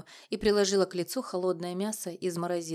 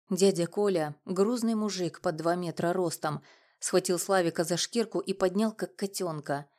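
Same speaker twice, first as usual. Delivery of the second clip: plain reading voice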